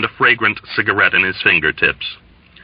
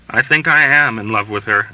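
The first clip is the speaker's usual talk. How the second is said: ordinary delivery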